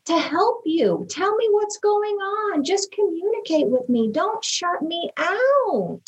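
wife retelling her plea to her withdrawn husband, desperate; frustrated pleading. Retold or imitated. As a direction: press him, then slow right down on please don't shut me out. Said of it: "I was full of anger".

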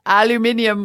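"Aluminium" in full, al-you-MIN-ee-um, high and loud; British "Aluminium" with the extra ee before the last syllable; British pronunciation. Shown as a demonstration. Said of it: "which I also love".

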